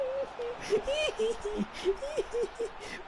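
very high squealing laughter; shrieking helpless laugh; laughing till it hurts; hysterical laugh. Real, not acted.

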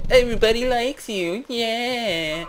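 high, hammed-up fan voice; mock adoring cheer. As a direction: gush it in a silly high voice. Voices admirer in improvised story.

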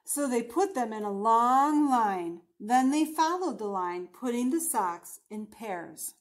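deliberate picture-book narration, stretching key words; storybook voice with drawn-out line endings; story reading to children. A narrator's reading. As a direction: read it out expressively for young listeners. Reads picture book aloud.

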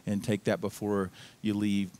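ordinary talk, nothing marked

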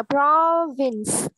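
"Province" with a strong first syllable; first-syllable stress. Shown as a demonstration. Teacher showing where stress goes, first-syllable stress. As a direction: lean on the start of "Province", let the second syllable fall. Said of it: "Âm tiết thứ nhất được nhấn nha".

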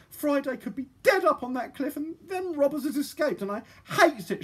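put-on high, wailing girl; helpless, tearful outburst; crying with rage. A narrator's reading. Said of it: "helpless and crying with rage".

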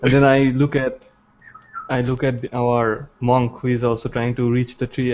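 ordinary delivery

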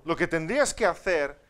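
loud, know-it-all husband giving orders; husband lecturing his wife, loud and bossy; mechanic mode husband. Retold or imitated. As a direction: lay down the fix like a mechanic. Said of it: "nos ponemos en plan mecánico".

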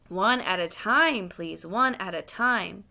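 slow, measured policeman's order; officious policeman. Acted. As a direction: hold the crowd back slowly and firmly. Policeman restrains clamouring complainant.

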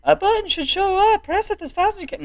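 very high mock Irish announcer; terrible Irish accent. Game host explaining the button, terrible Irish accent. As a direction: give instructions in a squeaky fake Irish accent. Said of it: "my terrible Irish accent".

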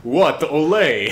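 loud, laughing mock Spanish accent sliding into Russian; failed Spanish accent. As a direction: attempt a Spanish accent and miss. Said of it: "it just goes to my Russian accent".

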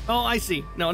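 ordinary talk, nothing marked

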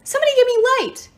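high, loud, fast frantic shout; scream. A narrator's reading. Nyx blinded in the dark, frantic scream. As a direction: scream for help in a rush. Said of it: "Nyx screamed".

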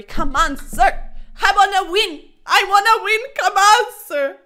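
loud, rising pleas to win; worked up. Real, not acted. Chess player demanding a win from opponent, heated and loud. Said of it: "I just got way too angry".

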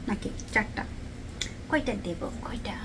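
soft whisper, counting under her breath; whispering to herself. Real, not acted. Vlogger counting prawns for her husband in a whisper. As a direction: drop to a whisper while deciding.

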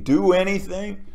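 ordinary delivery

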